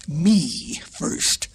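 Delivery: lion's demanding voice; lion crying out. A narrator's reading. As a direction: insist as the lion. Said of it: "cried the lion".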